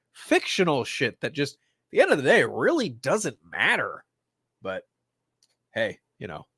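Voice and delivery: usual speaking voice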